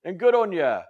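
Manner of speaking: mock Australian catchphrases; Australian accent. Shown as a demonstration. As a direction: toss it off in a broad Australian accent. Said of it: "not sound Australian".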